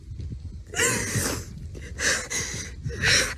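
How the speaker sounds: high unvoiced sob; a broken, breathy sob. Real, not acted. Crying while recalling being left behind.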